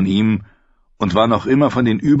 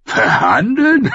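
high, loud, slow scoffing question; amused wildling scoff; mocking disbelief; 10 semitones higher, 4 dB louder, slower than usual. A narrator's reading. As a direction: sneer at the word. Tormund mocking the word.

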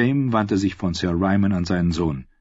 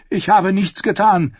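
pleading; 11 semitones higher, 5 dB louder than usual. A narrator's reading. Knight falls to his knees.

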